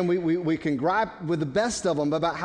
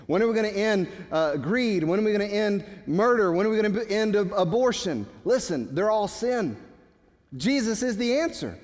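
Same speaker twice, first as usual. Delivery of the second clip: raised, louder preacher's cry, driving each question home; preaching at full force; 5 semitones higher, 3 dB louder than usual. Acted. Preacher pressing the congregation on sin, full-force preaching.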